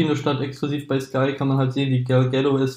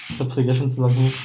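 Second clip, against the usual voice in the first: normal talking voice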